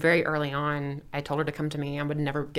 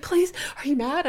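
put-on much higher small-girl voice; imitating a worried daughter asking; daughter pleading; 10 semitones higher than usual. Daughter asking mom for reassurance, high childlike voice. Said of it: "And she's like".

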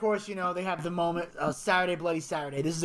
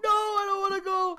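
high, wailing protest; hammed-up tearful pleading; mock crying; 13 semitones higher, 4 dB louder than usual. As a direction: wail it like a panicked kid. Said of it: "quit your fucking crying".

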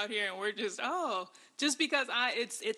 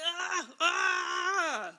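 frustrated cry; 9 semitones higher, 3 dB louder than usual. Real, not acted. Exasperated at the book.

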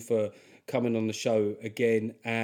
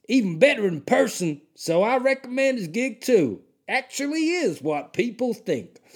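high-pitched, hammed-up Deep South voice; Deep South accent; 9 semitones higher than usual. Comic reading Willy Bob's review in a hammed-up Deep South accent. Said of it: "that has got to be a Deep South accent".

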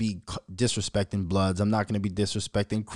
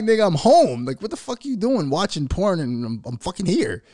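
high, raised-voice telling-off, easing slightly; mock scolding; 8 semitones higher, 4 dB louder than usual. Father at home catching son, acted indignant scolding. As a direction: tell him off loud and high.

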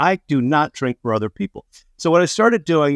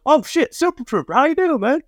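much higher, delighted fan surprise; surprised recognition; 13 semitones higher than usual. Retold or imitated. Angry stranger suddenly recognizing Super Trooper, high surprised. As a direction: gush as he recognizes the star. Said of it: "and he goes".

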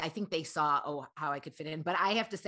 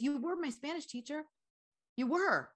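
insistent fan; 10 semitones higher than usual. Retold or imitated.

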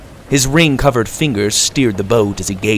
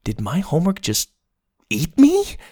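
surprised; 6 semitones higher, 3 dB quieter than usual. A narrator's reading. Boy's bewildered question.

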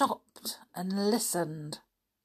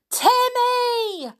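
shouted; 6 dB louder than usual. Acted. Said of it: "shouted George".

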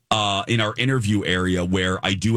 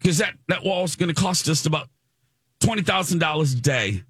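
higher, lightly British, matter-of-fact; dismissive British accent; 7 semitones higher than usual. Retold or imitated. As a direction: state the cost like a curt Brit. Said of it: "he was so dismissive".

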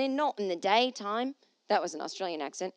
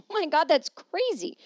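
surprised; 8 semitones higher, 6 dB louder than usual. Real, not acted. Laughing disbelief.

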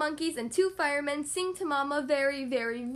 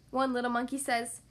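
plain reading voice

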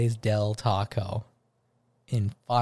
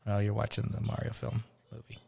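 usual speaking voice

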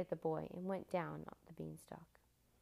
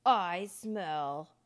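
very loud, drawn-out giant roar; thunderous storybook giant; booming giant; 11 dB louder than usual. A narrator's reading. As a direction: boom it out, menacing and huge. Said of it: "boomed the giant".

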